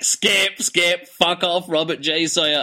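slow, exasperated "skip, skip"; annoyed skipping. Retold or imitated. Host retells his impatience at the author's foreword, exasperated. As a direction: drag it out, fed up with the intro. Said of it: "And I'm like".

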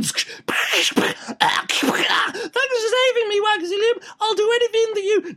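very high, squeaky put-on gushing; hammed-up high voice, swooning thanks; mock rescued admirer. Rescued person thanking Waxilium for saving them, high mock gushing.

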